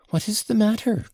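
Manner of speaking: put-on high, worried girlish call; girl crying out anxiously on hearing of hysterics. A narrator's reading. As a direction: ask in sudden alarm, pitched high. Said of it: "in a tone of real anxiety".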